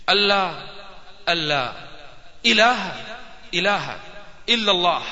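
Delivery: word by word, each piece said twice with pauses; short separated phrases, starting low and gradually rising; halting testimony of faith. Retold or imitated. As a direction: imitate a convert carefully repeating after a teacher. Convert repeats the testimony piece by piece.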